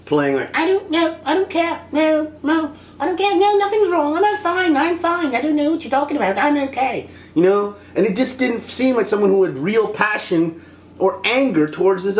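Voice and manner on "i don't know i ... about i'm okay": high-pitched, run-on sulking; high, petulant mock denial; mock disgruntled girlfriend. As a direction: mimic a huffy girlfriend insisting in a squeaky voice. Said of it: "almost like a disgruntled girlfriend who just was".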